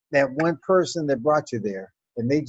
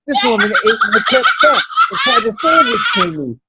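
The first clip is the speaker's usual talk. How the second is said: loud, higher, laughing indignation; outraged laughing rant; 9 semitones higher, 8 dB louder than usual. Real, not acted. Comic outraged rant about grandma eating the pet duck. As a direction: blow up in laughing outrage over the lost pet.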